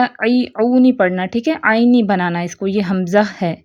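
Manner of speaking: ordinary talk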